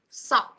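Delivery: "Sock" ending crisply on K; short single "Sock"; singular, no final S. Shown as a demonstration. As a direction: say "Sock" with no S after the K. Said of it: "nếu mà nó chỉ có một chiếc vớ".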